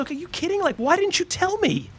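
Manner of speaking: mock outrage at the brother who signed him up; exasperated. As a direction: squeak out the disbelief at your brother. Retold complaint to his brother.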